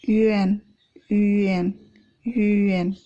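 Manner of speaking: "yuan" said as ü-en; yuan baca Ü-en. Shown as a demonstration. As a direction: front the u in "yuan", then en. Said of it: "bacanya adalah huruf U". ("yuan" dibaca ü-en)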